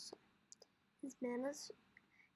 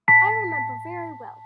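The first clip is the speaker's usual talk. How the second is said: father booms out the memory, much louder; loud fond recall; 3 semitones higher, 21 dB louder, faster than usual. Acted. Father recalling his son's birth, loud and fond. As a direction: call it out fondly, loud.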